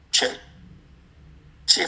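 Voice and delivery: usual speaking voice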